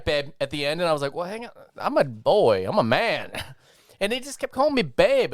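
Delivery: usual speaking voice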